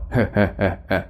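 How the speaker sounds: light rolling chuckle; easy teasing laugh. A narrator's reading. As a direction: chuckle a few times in a row. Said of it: "Bà Sáu cười".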